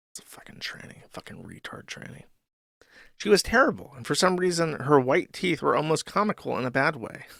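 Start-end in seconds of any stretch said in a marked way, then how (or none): 0.1-2.2 very quiet, unvoiced-sounding whisper; whispered aside. Real, not acted. Streamer muttering an insult aside while reading comments, whispered. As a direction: whisper it under your breath.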